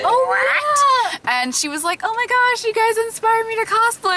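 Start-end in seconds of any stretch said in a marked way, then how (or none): 0.0-1.2 very high squeal of disbelief; squeaky, overcome astonishment; retold shock. Retells own shocked reaction to fan. 2.0-4.1 high-pitched mock fangirl gush; retold squeal of recognition, pitched way up; imitated excited fan.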